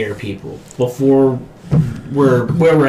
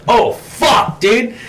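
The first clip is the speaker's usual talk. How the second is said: shocked gasp; 11 semitones higher, much slower than usual. Retold or imitated. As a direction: react to a knife through the throat. Shocked reaction to movie kill.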